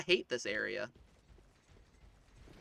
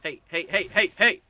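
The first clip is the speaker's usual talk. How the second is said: rapid heys climbing louder and higher; urgent shouting; 5 semitones higher, 10 dB louder than usual. Real, not acted. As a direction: shout hey repeatedly, building in volume and pitch. Calling out urgently during play.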